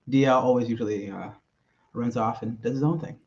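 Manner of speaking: normal talking voice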